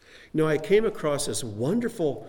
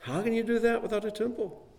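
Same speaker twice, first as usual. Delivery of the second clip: pleading question; 8 semitones higher than usual. Acted. Rhetorical question repeated with emphasis.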